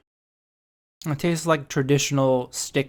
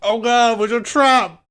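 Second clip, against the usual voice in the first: shocked; 8 semitones higher, 6 dB louder than usual. Real, not acted. Biting into filled gum.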